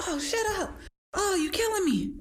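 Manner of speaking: high-pitched complaining client; whiny client. Retold or imitated. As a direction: wail it like an exhausted client.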